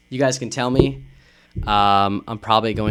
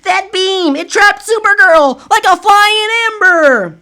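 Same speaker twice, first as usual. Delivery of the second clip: much higher, louder, drawn-out cry of alarm; high alarmed heroine; 9 dB louder, slower than usual. Acted. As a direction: shout it out as the shocked heroine.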